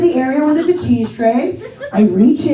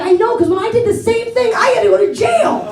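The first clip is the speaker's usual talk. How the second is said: guy confessing; 9 semitones higher than usual. Retold or imitated. Guy punchline, high, shouted.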